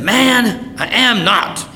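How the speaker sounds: loud, high-pitched indignant protest; emphatic denial. Acted. Peter denies knowing Jesus to an accuser, loud indignant denial. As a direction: protest it loudly, pitched well up.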